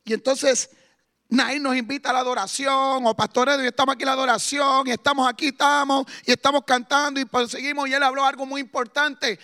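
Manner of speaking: loud, urgent exhortation; full-force preaching. Acted. Preacher urging the church to join worship, loud.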